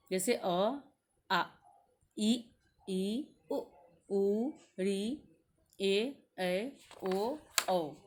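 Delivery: each vowel said clearly and apart; teacher chanting the vowel list; reciting vowels. Shown as a demonstration. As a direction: recite the vowels one by one for children. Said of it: "ये संख्या में ग्यारह होते हैं".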